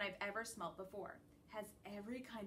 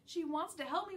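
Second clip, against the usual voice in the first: plain reading voice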